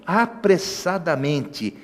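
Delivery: apressadamente drawn out slowly and softly; stress on apressadamente. Shown as a demonstration. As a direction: stretch out "apressadamente". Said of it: "que interessante". ("apressadamente" bem devagar)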